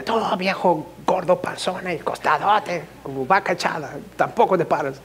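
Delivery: a hint of a scolding wife; wife's retort. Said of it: "Y ella le grita". (esposa respondiendo al marido, reproche fingido y suave)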